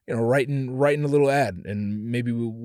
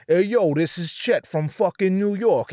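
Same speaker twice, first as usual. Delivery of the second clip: loud, higher-pitched mock New Yorker; New York accent; 6 semitones higher, 4 dB louder than usual. Comic imagining [name] as a New Yorker, New York accent. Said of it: "if he did a New York accent".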